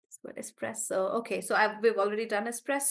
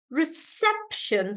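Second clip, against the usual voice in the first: reception slowly, SEP raised; stress on SEP; 4 semitones higher, slower than usual. Shown as a demonstration. Teacher demonstrating reception, stress on sep. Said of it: "stress is on sep".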